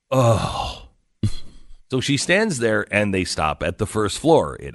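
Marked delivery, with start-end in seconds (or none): 0.1-0.8 imitated huffy groan; exasperated groan. Woman hearing about extra buttons, exasperated groan. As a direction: groan as if hugely put out. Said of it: "she just does this".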